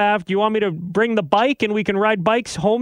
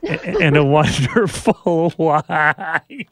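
teary laugh; much slower than usual. Real, not acted. Thanking wife while tearing up.

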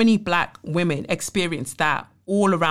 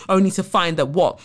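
ordinary talk

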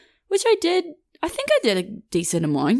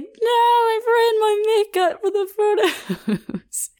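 overdramatic mock wail; slower than usual. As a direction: wail it like a hammed-up tragedy over ruined makeup. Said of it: "I was just being overdramatic".

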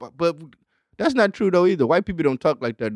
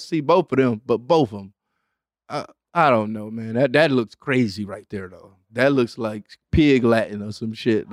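ordinary delivery

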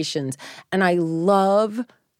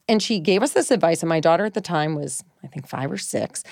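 normal talking voice

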